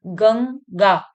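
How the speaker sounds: "गंगा" split as gang, gaa; nasal ng sound. Shown as a demonstration. Teacher showing pupils the ang sound, "गंगा" as gang-gaa. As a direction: ring the ng in "गंगा" before gaa. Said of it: "तो अंग का स्वर आ रहा है".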